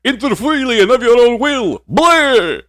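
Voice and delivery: high, loud hammy Dracula voice; Transylvanian accent. Pretend. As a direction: camp vampire count greeting guests. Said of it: "doing the vampire voice".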